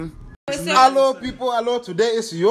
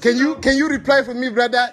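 ordinary delivery

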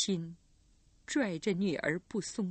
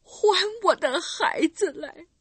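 1 demanding cry; 9 semitones higher, 6 dB louder than usual. Acted. Recluse demands her child.

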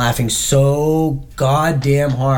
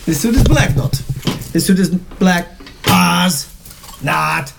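put-on Borat accent; 3 semitones higher than usual. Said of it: "Just like the Borat".

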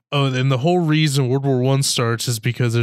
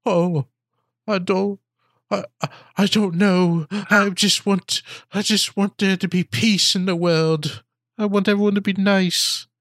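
high, halting idealist voice; mock hand-wringing; 7 semitones higher than usual. Host mocking movie characters standing around talking, mock hand-wringing. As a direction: put on a wishy-washy do-gooder pleading.

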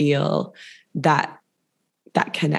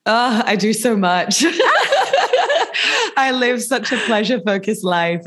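laughing while answering; words through a real laugh; 7 semitones higher than usual. Real, not acted. Laughing at own pleasure-focused life.

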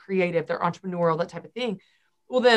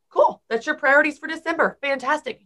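high upbeat approval; bright approving; 7 semitones higher than usual. Real, not acted. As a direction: cheer them on brightly. Cheerful approving response.

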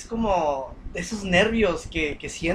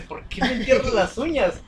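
mamá quejándose; 4 semitones higher than usual. Retold or imitated. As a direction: reclamo de madre, levemente actuado. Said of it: "mi mamá quejándose". (madre regañando al niño que le entierra las uñas, tono de queja ligero)

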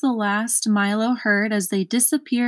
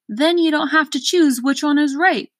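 higher, louder know-it-all advice; advising; 7 semitones higher, 4 dB louder than usual. A narrator's reading. Advisor advising Milo, higher and pompous. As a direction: lecture him like it's obvious. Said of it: "advised the third".

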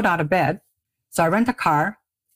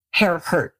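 usual speaking voice

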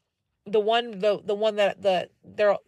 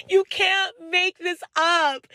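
amused disbelief; 7 semitones higher, 3 dB louder, slower than usual. Real, not acted. Amused disbelief after laugh.

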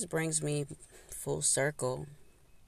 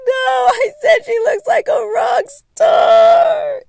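high, loud, drawn-out denial; panicked denial; 16 dB louder, slower than usual. Retold or imitated. The speaker denying it to the crying girl, in a high loud plea. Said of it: "I'm like".

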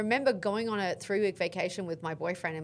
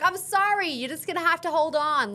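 mock firm refusal; 7 semitones higher, 4 dB louder than usual. Refusing him through the door.

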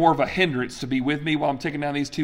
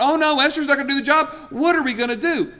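high, fast, mock-flustered panic; mock panicked God; 10 semitones higher, faster than usual. Imagined God panicking over Esther, high and hammed-up. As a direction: play a God in a hammed-up flap. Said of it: "God's not going".

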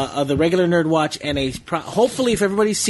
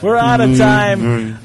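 radio sign-off voice; 4 semitones lower, 5 dB louder, much slower than usual. Acted. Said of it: "I haven't done that in forever".

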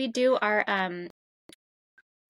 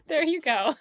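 ordinary talk, nothing marked

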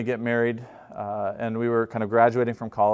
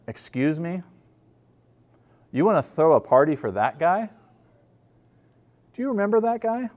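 put-on indignant older brother; 5 semitones higher than usual. Older son voiced, indignant at party.

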